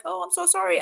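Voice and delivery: high-pitched imitation of an apologizer; mock emotional apology. Imitating people who apologize for getting emotional, mock apologetic. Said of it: "when people get emotional".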